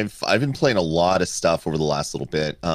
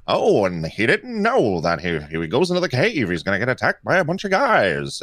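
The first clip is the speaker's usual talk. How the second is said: higher excited radio announcer; old-timey radio narrator; 5 semitones higher than usual. Retold or imitated. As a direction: play a dramatic 1950s newsreel narrator. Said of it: "old-timey radio voice".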